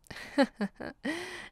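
high mocking laugh; a short amused cackle. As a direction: laugh at him, toying. Mistress amused by his question.

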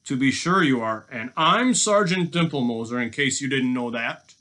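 mock agreement with heavy irony; sarcastic. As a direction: play along in open disbelief. Robber scoffs at captive's claim.

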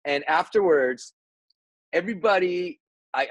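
ordinary delivery

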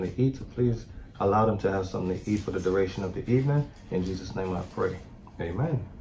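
soft, reverent prayer voice; quiet prayer. Real, not acted. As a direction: pray quietly over the meal. Prayer before the meal.

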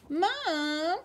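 high, drawn-out wail of Mom. A narrator's reading. Little girl wails to her mom after falling. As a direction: wail for mother like a hurt child. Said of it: "she wailed".

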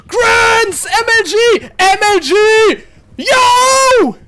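high, loud victory yells; triumphant shouting. Real, not acted. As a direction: yell out in triumph. Celebrating escape, shouting.

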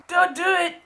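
very high mock-panicked squeal; panicked onlooker. Whiskey purist begs the host to stop, mock panic. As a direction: plead in a squeaky put-on voice. Voices a horrified viewer pleading.

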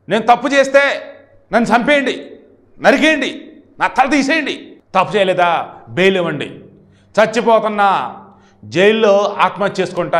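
high, loud, theatrical plea in short bursts; hammed-up, high-pitched mock threat; Posani Krishna Murali threatening. Posani pleading before the judge, mock threatening. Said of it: "జడ్జిని బెదిరిస్తున్నాడు".

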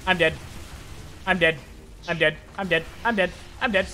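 ordinary delivery

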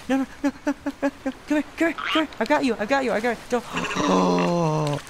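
desperate shouting to save it; frantic panic. Real, not acted. Sheep in danger, frantic rescue.

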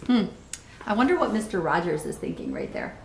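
plain reading voice

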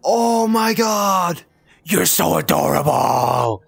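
stretched-out adoring shout; gushing excitement. Real, not acted. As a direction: melt over something adorable. Gushing over the creature.

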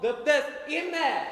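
kapı sesi taklidi. Acted. Otobüs kapısı sesi ve uyarı.

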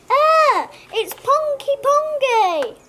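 high, slow disgusted whine. A narrator's reading. Boy sniffing grapefruit, disgusted, high and slow. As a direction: recoil from the smell.

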